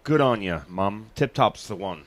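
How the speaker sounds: hammed-up Aussie accent; Australian accent. Asked for British, does a loud Australian accent to the hosts. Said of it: "[name], let's do your British".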